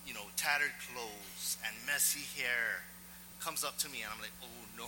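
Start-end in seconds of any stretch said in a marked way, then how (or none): 4.4-4.8 quietly retold sinking dread; dismayed aside. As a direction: groan it softly under your breath, dreading what comes. Dread aside to self on seeing stranger.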